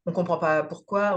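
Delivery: ordinary delivery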